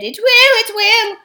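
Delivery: high, loud frightened wail; wailing and whining. Acted. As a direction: whine it pleadingly like a poor creature. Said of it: "wailed and whined and said".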